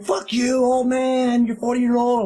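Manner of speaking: high, jeering heckle; mouthing off. Retold or imitated. Teens insult the older driver from their car, mouthing off. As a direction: imitate cocky kids throwing insults. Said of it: "just mouthing off at me".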